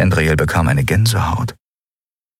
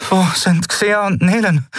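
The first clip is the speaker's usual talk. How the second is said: urgent question; 12 semitones higher than usual. A narrator's reading. Endriel asks urgently.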